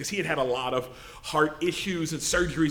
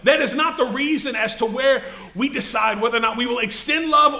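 rising, emphatic sermon delivery; preaching; 5 semitones higher, 4 dB louder than usual. Acted. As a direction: preach it to the room with conviction. Preaches to the congregation.

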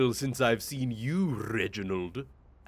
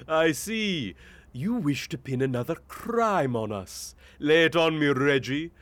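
ordinary delivery

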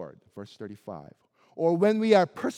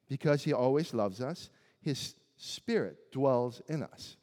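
softer repeat; 6 semitones lower, 6 dB quieter than usual. Shown as a demonstration. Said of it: "repeated point, quieter".

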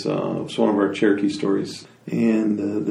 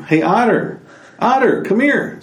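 high, raised call to Otter; 12 semitones higher, 5 dB louder than usual. Acted. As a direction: holler a friend over. Animals call Otter over from the beach.

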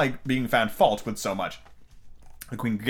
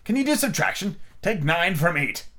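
examining queen; 7 semitones higher than usual. A narrator's reading. Red Queen testing Alice on subtraction, imperious. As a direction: test the pupil as an imperious queen.

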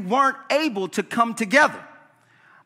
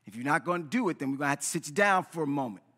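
lower, softer firm coach talk; stern coach; 7 semitones lower, 7 dB quieter, faster than usual. Retold or imitated. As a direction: hold the player to account. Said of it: "it's a compelling cry".

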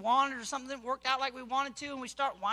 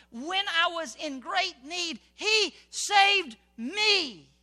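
slow, swelling declaration; 6 semitones higher, 8 dB louder, much slower than usual. Real, not acted. Preacher proclaiming the psalm's rescue, slow and loud. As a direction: stretch out each word of saved me. Scripture climax drawn out.

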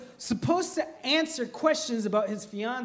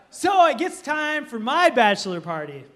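normal talking voice

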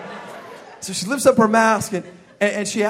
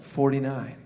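low, soft, woozy drift; drugged counting; 10 semitones lower, 12 dB quieter, slower than usual. Acted. Patient counting backwards from fifty on gas, woozy and low. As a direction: count as if sinking under laughing gas.

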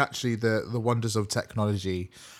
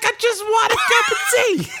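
high, loud, put-on Bronx accent; shouted campy Bronx diva accent; mock Coco Peru; 6 dB louder than usual. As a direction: belt it out in a campy Bronx twang. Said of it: "She's like".

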